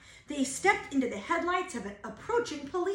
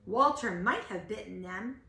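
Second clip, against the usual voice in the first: usual speaking voice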